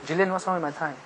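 ordinary talk

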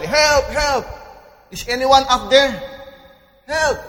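high, loud cry for help; high-pitched acted yell; shouting for help. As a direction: call up from far below, desperate to be heard. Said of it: "So he shouted".

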